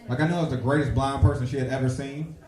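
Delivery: normal talking voice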